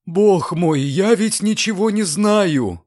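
high, drawn-out fearful whine; scared landlady whining; frightened whimper. A narrator's reading. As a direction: whimper in fright, pitched well up. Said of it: "захныкала в конец испуганная домовладелица".

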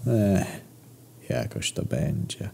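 a touch of mournful resignation; with regret. Acted. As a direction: let it sink in sorrow. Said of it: "odparł z żalem".

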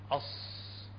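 أص with a long hissed ص; soft, sustained sibilant ending on أص; whistling Sad. Shown as a demonstration. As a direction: hold the ص of أص as a hiss. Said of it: "هذا الصوت".

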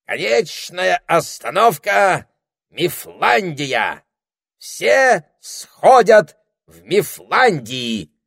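high, loud, slow station call; parrot announcing. A narrator's reading. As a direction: announce the stop like a parrot conductor. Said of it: "провозгласил попугай".

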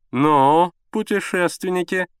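loud drawn-out opener, then high; jovial, singled-out greeting; hearty father. A narrator's reading. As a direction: hail the young travellers teasingly.

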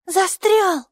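put-on high, loud child's exclamation; stuck, child's cry. A narrator's reading. Майки tugs at the root, high loud exclamation. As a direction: call it out high, with a falling end.

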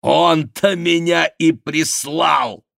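выкрикнуть громче и выше, растягивая слова; медленный, высокий, вызывающий возглас; торжествующий выкрик. Acted. Монсоро бросает вызов врагу. (вызывающий выкрик, нараспев)

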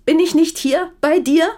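high, loud defensive snap; angry retort. Acted. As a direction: throw it back at him. Wife fires back in quarrel.

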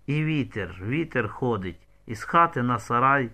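ordinary delivery

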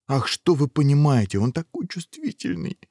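high, fussy exclamation; indignant defence. Acted. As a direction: protest on the prince's behalf. Locals defend their sensitive prince.

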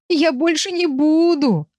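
high, drawn-out wailing plea. A narrator's reading. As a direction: cry out a desperate promise. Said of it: "вопил Виль". (вопя, умоляюще)

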